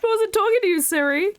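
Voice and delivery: high, loud, playfully offended; indignant. Real, not acted. Streamer telling off Siri for butting in, loud mock indignation. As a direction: scold the phone in mock outrage.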